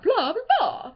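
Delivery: high, swooping vampire blah; sing-song mock vampire catchphrase; cartoon vampire impression. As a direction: playfully imitate a movie vampire. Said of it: "that one part in Hotel Transylvania".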